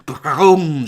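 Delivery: loud rolled crash imitation; booming crash noise. A narrator's reading. Narrator falls down the stairwell, booming crash noise. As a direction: boom it out like a heavy tumble.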